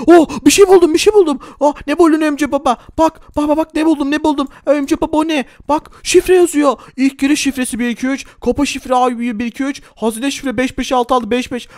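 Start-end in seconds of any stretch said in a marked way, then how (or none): 0.1-6.8 excited find. Acted. Excited find in game roleplay.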